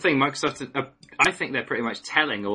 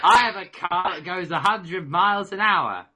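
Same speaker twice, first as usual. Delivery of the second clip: higher, boastful character voice; put-on voice; 6 semitones higher than usual. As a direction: brag like a cartoon hero. Movie line impression.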